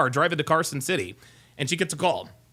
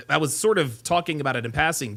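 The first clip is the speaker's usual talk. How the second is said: normal talking voice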